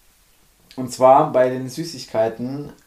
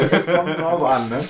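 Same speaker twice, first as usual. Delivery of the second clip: laughing; 4 semitones higher than usual. Retold or imitated. Teasing repeat of friend's phrase.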